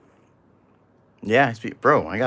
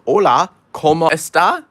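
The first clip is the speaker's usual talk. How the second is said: higher, louder hammed-up Spanish accent on "Hola, ¿cómo está?"; mock Spanish greeting; 6 semitones higher, 6 dB louder than usual. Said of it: "I understand nothing of Spanish except".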